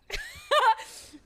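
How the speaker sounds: short, high, loud laugh; burst of laughter. Real, not acted. Player laughs at own Los Angeles accent, high burst of laughter.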